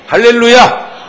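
크게 높여 외치는 할렐루야; 회중을 깨우듯 힘껏 외쳐. Real, not acted. Preacher calls to congregation. (설교자가 회중에게 크게 외치는 할렐루야)

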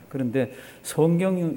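ordinary talk

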